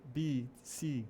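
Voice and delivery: "B, C" with lengthened ee; long vowel. Shown as a demonstration. Teacher repeating the examples, long ee in "B, C". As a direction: hold the vowel in "B, C". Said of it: "ele é um som mais alongado".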